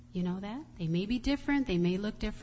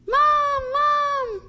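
very high, loud cry for mom; shrill-pitched acted scream; imitated child's scream; 8 dB louder than usual. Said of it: "she started screaming".

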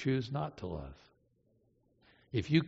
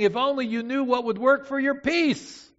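1 pleading parent; 9 semitones higher, 4 dB louder than usual. Retold or imitated. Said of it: "And you're saying".

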